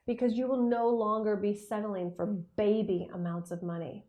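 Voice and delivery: ordinary talk, nothing marked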